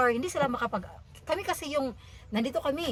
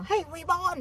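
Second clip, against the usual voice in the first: mocking insult; 6 semitones higher, slower than usual. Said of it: "inunsulto nila si Manuel".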